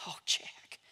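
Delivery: a soft, unvoiced whisper; whispered grief. Weeping mother to her son, in a hushed whisper. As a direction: breathe it out through tears.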